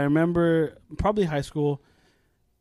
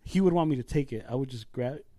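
ordinary talk, nothing marked

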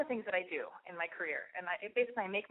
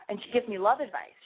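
ordinary talk

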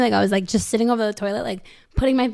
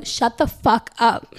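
blunt retort; 3 dB louder, much slower than usual. Retold or imitated. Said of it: "And I literally go".

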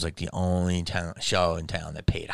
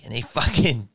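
usual speaking voice

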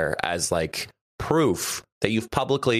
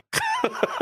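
high laugh. Real, not acted. Laughing at photo request.

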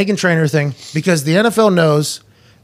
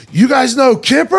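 higher and louder, an Uber driver thrilled to hear the name; excited shout; 7 semitones higher, 4 dB louder than usual. Retold or imitated. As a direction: blurt it out in delight. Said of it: "And the fucking guy goes".

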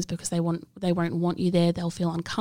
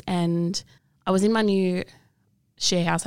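ordinary talk, nothing marked

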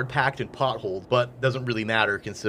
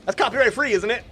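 high, louder Frank voice; character voice; 10 semitones higher, 4 dB louder than usual. Acted. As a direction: voice a cheery crew member. GM voicing NPC Frank.

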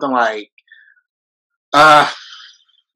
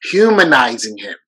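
ordinary talk

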